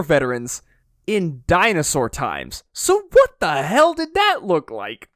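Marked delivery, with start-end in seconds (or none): 2.7-5.1 incredulous. Real, not acted. Incredulous question about war scenes.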